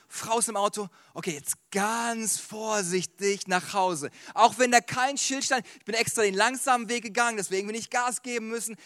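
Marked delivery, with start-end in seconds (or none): 1.1-4.1 drawn-out, careful slow delivery; acting out a careful driver, slow; very cautious. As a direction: ease each word out slowly. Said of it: "Ich bin vorsichtig gefahren".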